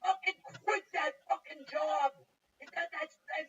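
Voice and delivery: loud, high, slow order; angry father. Retold or imitated. Father ordering him to quit.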